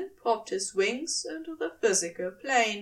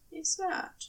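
plain reading voice

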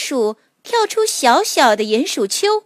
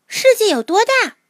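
put-on high, curious child voice; little mole voice; 11 semitones higher than usual. A narrator's reading. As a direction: ask like a small wide-eyed creature. Mole asks the horse.

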